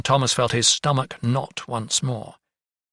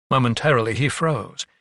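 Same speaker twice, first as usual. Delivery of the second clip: usual speaking voice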